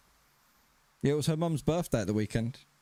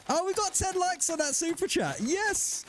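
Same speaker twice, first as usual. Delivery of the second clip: much higher, delighted cheer; excited; 14 semitones higher than usual. Real, not acted. Streamer thrilled that chat hit ten likes, excited. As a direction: celebrate the target being hit. Celebrating reaching the likes target.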